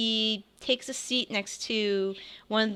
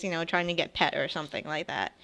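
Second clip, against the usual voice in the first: ordinary talk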